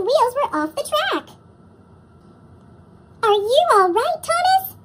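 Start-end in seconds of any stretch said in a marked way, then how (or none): 3.2-4.8 high, louder worried call; raised anxious call. Acted. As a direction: call across the bridge to a stuck friend. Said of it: "called Henry".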